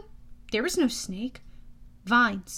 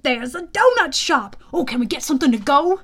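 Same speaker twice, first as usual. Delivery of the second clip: excited, louder, quick plea; higher, louder and eager; eager flying horse; 3 semitones higher, 8 dB louder than usual. Acted. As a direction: beg like an excited sidekick.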